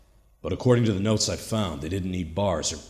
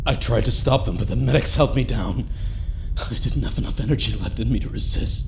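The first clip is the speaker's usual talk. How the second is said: higher and louder, frantic as the medics hold him down; desperate alarm; 7 semitones higher, 7 dB louder than usual. Acted. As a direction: fight the restraints and shout the warning. Held down, warning about the device.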